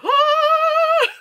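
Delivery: very high, loud shriek at the screen; just blurt out a scream. Retold or imitated. Vlogger reacting in the theater, loud high shriek. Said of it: "I just verbally went".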